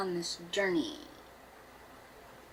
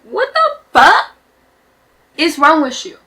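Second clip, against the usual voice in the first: outraged shout; 6 semitones higher, 11 dB louder than usual. Real, not acted. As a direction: yell in disbelief. Outraged reaction.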